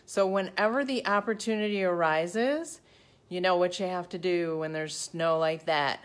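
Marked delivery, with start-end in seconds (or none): none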